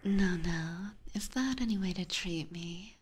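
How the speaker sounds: ordinary delivery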